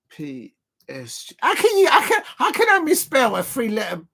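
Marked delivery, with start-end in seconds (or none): 1.4-4.0 high, loud exasperation; can't believe what you did; exasperated disbelief. Real, not acted. Disbelief at his own mistake.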